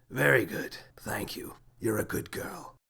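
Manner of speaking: hushed, whispery and sorrowful; soft, sad near-whisper. A narrator's reading. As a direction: whisper it sadly, letting it fade. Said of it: "nodded sadly and said".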